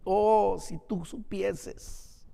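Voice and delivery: soft, drawn-out lament, fading away; retold lament. Jesus lamenting over the city, softly mournful. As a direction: let it sink into a slow, hushed sorrow. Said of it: "a lamentarse sobre la ciudad".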